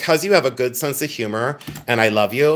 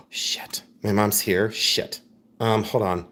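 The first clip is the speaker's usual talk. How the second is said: breathy gasp then hushed swearing; panicked whisper; 5 semitones lower, 6 dB quieter, slower than usual. Real, not acted. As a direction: caught out, whispering in alarm. Panicked whisper on seeing mom.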